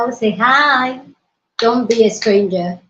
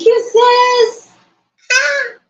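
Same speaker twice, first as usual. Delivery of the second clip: high squealing cry of joy; delighted squeal; 14 semitones higher, much slower than usual. Real, not acted. Streamer thanking a viewer, delighted high squeal.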